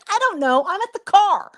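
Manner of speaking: put-on high-pitched defensive kid; imitated exasperation. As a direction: imitate a son fed up with questions, voice climbing. Imitating exasperated son on phone.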